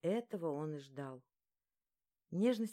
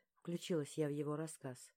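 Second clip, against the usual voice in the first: ordinary delivery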